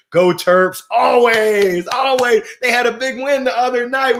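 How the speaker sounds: loud, high rallying cheer; proud fan calling out; team cheer. Real, not acted. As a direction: cheer them on, voice lifting.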